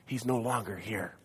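quiet, awed he's alive; hushed awe. Acted. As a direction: drop to a hush to tell them. Hushed climax of resurrection.

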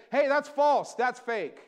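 loud warning call. Retold or imitated. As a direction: bark it out like a watchdog. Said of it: "I'm gonna say".

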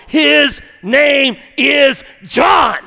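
slow, loud and drawn out; emphatic proclamation. Acted. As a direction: carve each word like it's final. Mute preacher writing the name.

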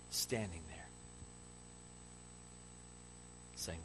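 very low, soft, reverent voice; near-whisper with long pauses; quietly awed, tender sermon vision; hushed preaching. Real, not acted.

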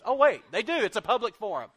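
spring the punchline with a loud, high exclamation; mock surprise. Real, not acted. Preacher scolding young people's posts, loud and high in mock surprise.

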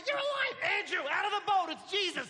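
high, laughing disbelief; overjoyed. Acted. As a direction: burst with joy at seeing him alive. Peter calls Andrew from boat.

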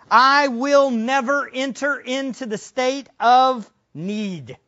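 slow, weighty, stressing "never"; emphatic quote. Shown as a demonstration. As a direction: press each word of "I will never enter". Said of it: "It's emphatic".

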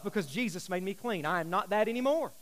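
ordinary talk

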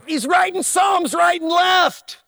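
loud, declaimed psalm reading; loud preaching. Acted. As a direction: proclaim it like David. Preaching at full force.

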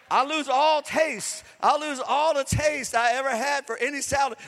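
fired-up preacher proclaiming; full-force preaching. Acted. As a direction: build the crowd up and lean on every word.

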